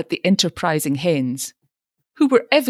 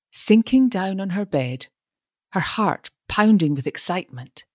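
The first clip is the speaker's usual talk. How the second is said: plain reading voice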